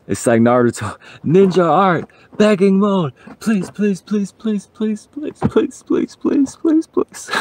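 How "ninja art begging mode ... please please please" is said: frantic pleading climbing much higher, fading to breathy squeaks; anime begging mode. Pretend. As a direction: beg like a cartoon ninja, higher and higher.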